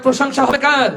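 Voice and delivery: rallying call to crowd. Real, not acted. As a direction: throw the question out to the crowd. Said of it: "এত আস্তে বললে".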